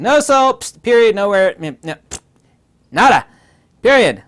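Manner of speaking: loud, high, cut-off bursts; mock dismissal. Lecturer acting out a flat denial of soul, loud mock dismissal. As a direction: shut down every question in short loud bursts. Mimicking a curt dismissal of questions.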